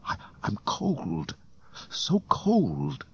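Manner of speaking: slow, faltering, rising to a high thin cry; frozen, faltering plea; freezing and weak. A narrator's reading. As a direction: shiver the words out. Freezing hero whispers weakly.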